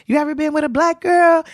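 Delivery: high-pitched call to the stage; yelled heckle. Retold or imitated. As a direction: holler it up at the comic. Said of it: "I yelled out".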